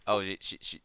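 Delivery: usual speaking voice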